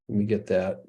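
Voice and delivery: usual speaking voice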